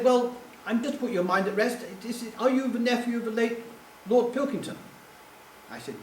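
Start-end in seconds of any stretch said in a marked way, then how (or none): 0.0-4.8 lightly imitated posh English caller, pitched up; high, well-to-do English telephone manner. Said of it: "this very posh voice said".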